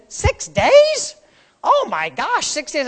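put-on high, surprised child voice; imitating son. Pastor mimics his son's shock in the car, high-pitched. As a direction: squeak out a stunned kid. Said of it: "And he said".

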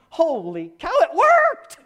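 high, loud yelp of amazement; astonished shout. Retold or imitated. Pastor amazed the healing happened, astonished high shout. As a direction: holler it in high disbelief.

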